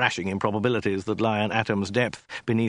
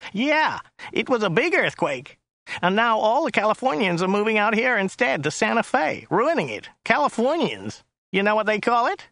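high, nasal complaining driver; Joe's whiny voice; 10 semitones higher than usual. A narrator's reading. Driver complaining about newcomers to his passenger, high and whiny. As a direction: grumble in a high whine. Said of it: "His voice was rather high and whiny".

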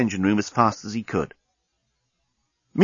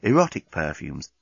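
ordinary delivery

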